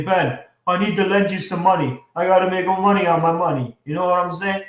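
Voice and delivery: hammed-up Godfather-style accent; amateur Italian-American mobster accent, badly faked; horrible mobster accent. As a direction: do a bad mob movie accent. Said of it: "That's my horrible accent".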